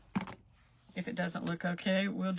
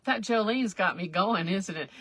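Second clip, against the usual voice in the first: ordinary delivery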